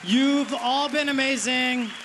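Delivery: high, projected thanks over applause; stage sign-off. Real, not acted. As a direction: call out to the crowd as you close the set. Comic signs off over applause.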